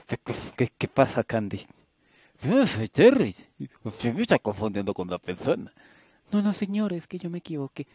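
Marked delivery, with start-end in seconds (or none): 2.4-5.7 sharp, much louder denial; firmly insist, voice raised; loud protest. Acted. Stranger protests being mistaken. 6.3-7.8 high embarrassed rush; flustered apology. Acted.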